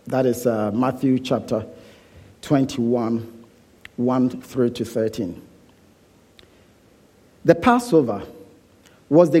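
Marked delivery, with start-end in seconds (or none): none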